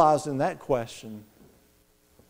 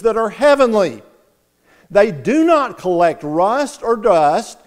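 louder, ringing sermon voice; preaching at full force; 3 semitones higher, 3 dB louder than usual. Acted. Preacher to his congregation, rising full-force preaching. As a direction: lift the room with hope.

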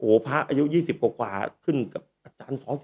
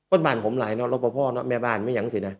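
ordinary talk, nothing marked

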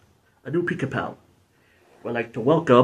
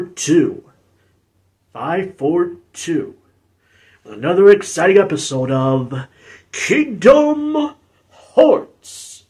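loud, drawn-out episode announcement; show intro announcer; 7 dB louder, slower than usual. Acted. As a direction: announce the show big, stretching the title.

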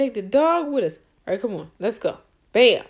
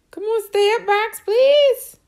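excited pleading; 9 semitones higher, 6 dB louder than usual. Real, not acted. Player begging box not to fall.

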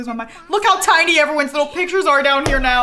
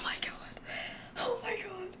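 stunned whisper; 6 semitones higher, 20 dB quieter than usual. Real, not acted. Player whispering in shock at the birth. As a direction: breathe it out in disbelief.

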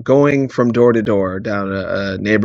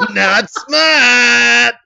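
gleeful Dafoe shriek; 13 semitones higher, 7 dB louder, much slower than usual. Retold or imitated. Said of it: "He's like".

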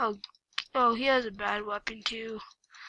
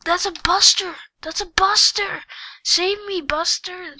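high, loud panicked cry; panicked shout; 7 semitones higher, 7 dB louder than usual. Real, not acted. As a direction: yell it in panic. Panics at the Buster.

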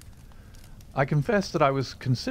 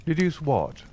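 petulant; 6 semitones higher than usual. A narrator's reading. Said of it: "said he petulantly".

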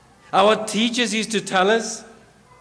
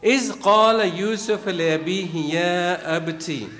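fast, measured Quranic recitation; quick devotional recital of scripture; 3 semitones lower, faster than usual. Acted. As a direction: recite the verse in flowing Arabic cadence. Recites verse.

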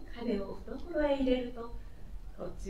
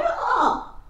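high, breathless rustic blurt; breathless outburst; 14 semitones higher than usual. Acted. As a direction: gasp it out, stunned and high. Country man bursting out to master.

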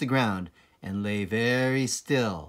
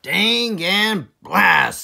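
slow, loud, much higher cursing farmer; gruff farmer Boggis; 7 semitones higher, 10 dB louder, much slower than usual. Acted. Boggis cursing at the missed fox, slow loud oath. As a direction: spit out the curse slowly. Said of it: "said Boggis".

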